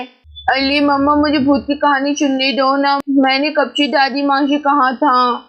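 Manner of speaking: ordinary talk, nothing marked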